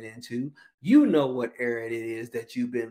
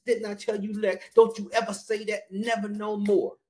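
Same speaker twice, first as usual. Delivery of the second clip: higher, sharp scolding; a shop owner losing his temper, half acted; mad scolding; 6 semitones higher than usual. Pet store owner scolding the parrot, mildly mock angry. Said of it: "he was so mad".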